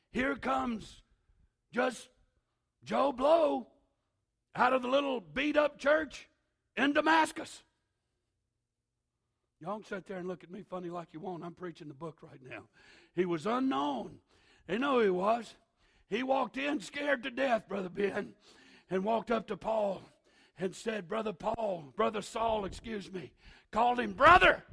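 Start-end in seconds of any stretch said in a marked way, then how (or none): 0.1-7.6 loud, high, climbing preacher's call; preaching at full force. Acted. Pastor to congregation, Joe Blow arrives, preaching full force. 13.1-24.6 loud, high, ringing sermon shout; shouted preaching. Acted. Pastor retelling Ananias to Paul, loud preaching shout.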